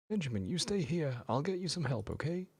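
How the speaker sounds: mother-hen reassurance in a high voice; teacher reassuring an injured student; fussy teacher, soothing. Acted. Scalar comforts Ben.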